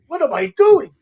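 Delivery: loud and high, bewildered; asking himself in shock; stunned. Retold or imitated. Rookie coming out of the fire, stunned and loud.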